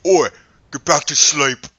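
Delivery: gruff shout from far off; grumpy mate yelling to go back to sleep; Barrick yelling. Acted. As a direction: bark it across the camp, half asleep. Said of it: "Barrick in the distance go".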